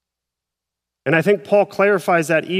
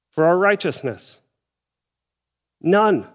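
ordinary talk, nothing marked

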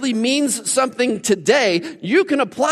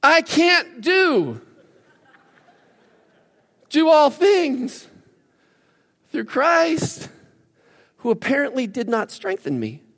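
mock injured lament; 6 semitones higher, slower than usual. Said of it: "broken arm".

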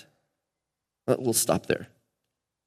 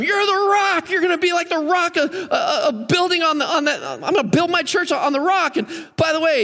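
much higher, mock-excited retelling of the praise; mocking high recap; 11 semitones higher than usual. Mocking recap of Peter's fall.

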